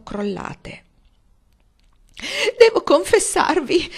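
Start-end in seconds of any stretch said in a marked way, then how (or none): 2.6-3.9 much higher, louder tearful wail; sobbing. A narrator's reading. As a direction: sob it out in distress. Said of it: "singhiozzò". (singhiozzando disperata)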